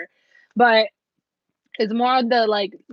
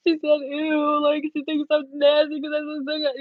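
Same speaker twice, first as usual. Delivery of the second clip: mock hurt whine; 5 semitones higher than usual. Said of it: "this one over here is just like".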